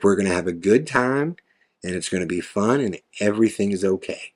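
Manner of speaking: low, soft imitated Southern woman; quiet, reassuring attempt at a Southern accent. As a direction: soothe in a lightly put-on Southern accent. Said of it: "soft Southern accent".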